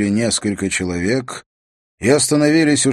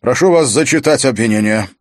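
raised, formal request; louder, higher official order; louder announcement; 4 semitones higher, 6 dB louder than usual. A narrator's reading. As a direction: say it up for the whole hall. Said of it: "затем громче произнёс".

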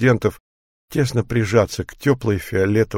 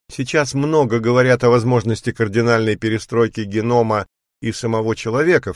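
usual speaking voice

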